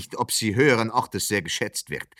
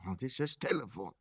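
annoyed mutter; 2 semitones lower, 14 dB quieter than usual. Acted. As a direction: mutter it under the breath. Annoyed aside during phone call.

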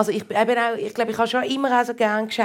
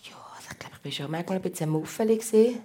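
sheepish whisper; 8 dB quieter, faster than usual. Real, not acted. Admitting being a grumpy child.

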